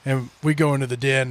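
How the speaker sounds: usual speaking voice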